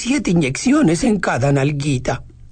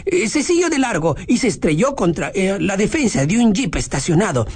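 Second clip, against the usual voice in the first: ordinary delivery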